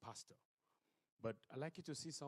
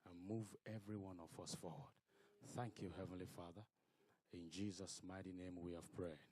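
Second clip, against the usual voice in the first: softer, hushed prayer voice; 3 semitones lower, 3 dB quieter than usual. Acted. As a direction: pray reverently to the Father. Leads a prayer.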